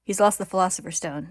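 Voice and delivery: a touch of triumph, narrated; mild triumph. A narrator's reading. Narrator voices Ed's thought about his enemy, mildly triumphant. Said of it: "Ed thinks triumphantly".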